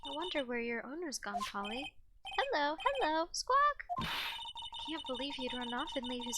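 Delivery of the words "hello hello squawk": high squawking bird voice; parrot voice. Acted. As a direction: screech it like a parrot. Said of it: "I'm not very good at doing a parrot voice".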